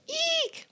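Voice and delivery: tiny high squeak of fright; mouse squeak. Acted. Reader squeaks in fright, very high.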